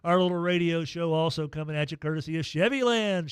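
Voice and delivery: ordinary delivery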